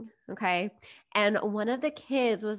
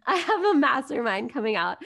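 laughing; 5 semitones higher, 6 dB louder than usual. Real, not acted. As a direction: still giggling, a bit louder. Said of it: "restarts after laughing".

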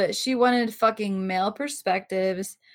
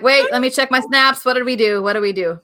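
mock panic; 4 semitones higher, 9 dB louder than usual. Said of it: "That's what I'm like".

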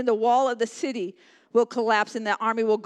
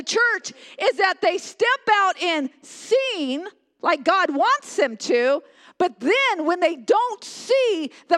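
high, loud, slow preaching; 7 semitones higher, 4 dB louder, slower than usual. Acted. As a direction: preach it with full force. Preaching at full force.

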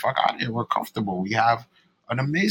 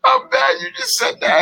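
laughing shock; 4 dB louder than usual. Real, not acted. Shocked at partner's remark.

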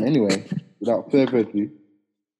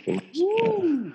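excited whoop. Real, not acted. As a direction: whoop it up for a mate. Cheering a fellow guest's hometown.

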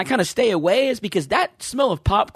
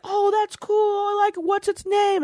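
high, slow, airy greeter voice; chipper greeter; 14 semitones higher, slower than usual. Retold or imitated. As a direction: imitate an overly friendly greeter. Mimicking the cheerful Walmart greeter.

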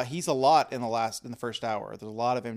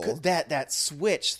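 ordinary talk

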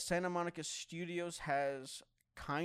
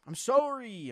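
loud, high, exaggerated apology; hammed-up plea, pitch swooping down; mock apology; 10 semitones higher, 9 dB louder, slower than usual. Joking apology after the insult.

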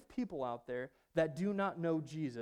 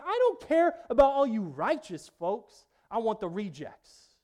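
bold declaration; 4 semitones higher, 8 dB louder than usual. Acted. Preacher voicing Jesus' declaration.

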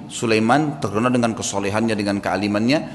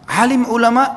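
surprised; 10 semitones higher, 4 dB louder than usual. Acted. Son shocked at who it is.

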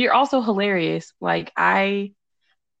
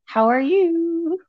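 excited greeting; 11 semitones higher, 5 dB louder, slower than usual. Real, not acted. Greeting the guest in high voice.

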